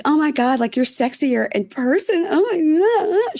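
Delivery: high, goofy fangirl gushing; super goofy gushing. Retold or imitated. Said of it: "She's acting super goofy".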